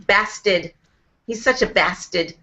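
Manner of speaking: put-on bastard in a Boston accent, no r, pastid; a light Boston take on such a bastard. Mimicking her Boston boss, lightly voiced Boston accent. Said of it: "an official word they have to learn how to say".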